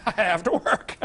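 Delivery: chuckling through the words; a joke half-laughed out; laughing. Real, not acted. Joking he's not done working.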